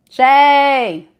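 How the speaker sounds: sudden loud call of the name. Real, not acted. Reader calling out to Shay, loud and sudden. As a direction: shout her name to get her attention.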